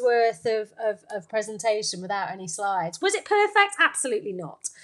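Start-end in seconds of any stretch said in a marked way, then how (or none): none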